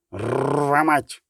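rasping screech with a rolled R; Field Marshal Duda. A narrator's reading. Unseen Field Marshal curses in the chaos, screeching. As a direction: grate out the curse, trilling the R long. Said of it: "заскриготів невидимий фельдмаршал Дуда".

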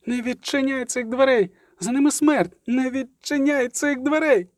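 urgent high voice of alarm; Ciri's cry. A narrator's reading. Ciri warns of death behind the door, crying out. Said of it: "крикнула Цірі".

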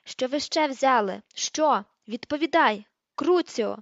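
loud, forceful questions building to a cast curse; furious interrogation. A narrator's reading. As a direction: demand an answer, then strike. Bellatrix interrogates, then casts torture curse.

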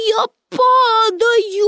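high, long-drawn mock cry; calling out. A narrator's reading. Pippi leaping from the roof, calling out. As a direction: stretch it out high as if falling. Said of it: "гукнула вона".

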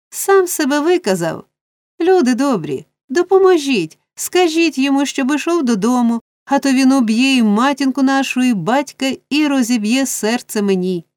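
high, pleading cry to onlookers; wailing appeal. A narrator's reading. Nancy begging good people for help, wailing. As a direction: plead high, then come down toward the end. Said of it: "заволала до присутніх Ненсі".

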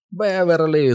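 high, drawn-out yell; hollered call. A narrator's reading. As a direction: holler her name for help. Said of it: "знову загорлав Біл".

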